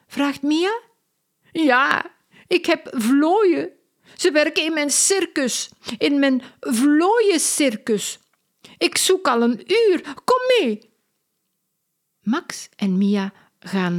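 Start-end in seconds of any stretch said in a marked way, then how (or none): 1.5-10.9 high-pitched, eager circus woman; fussy circus artist, much higher voice; excitable circus lady. A narrator's reading. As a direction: play Lola, breathless with worry. Lola the circus lady speaks.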